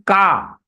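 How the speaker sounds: Ka said as "kaa" with open "aah"; long vowel. Shown as a demonstration. Teacher shows what it became, Ka with long "aah". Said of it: "when the half Ka is attached with A".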